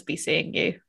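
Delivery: low, mock film line; mock movie line. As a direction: deliver it low like a movie sign-off. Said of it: "Nobody says".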